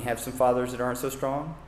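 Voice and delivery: ordinary talk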